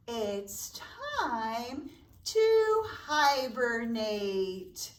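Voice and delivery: plain reading voice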